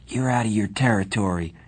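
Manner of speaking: ordinary delivery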